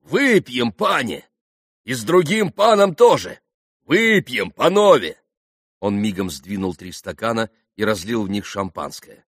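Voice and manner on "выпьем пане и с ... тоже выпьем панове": high, loud toast invitation; rally the company to drink; hearty shout. A narrator's reading. Mitya urging the Polish gentlemen to drink, shouting heartily. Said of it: "крикнул Митя".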